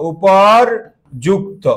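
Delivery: উপরযুক্ত drawn out slowly, each syllable clear; correct form. Shown as a demonstration. Teacher correcting the class's spelling, slow and deliberate. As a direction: stretch উপরযুক্ত slowly so students catch it. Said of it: "শব্দটা উপরযুক্ত".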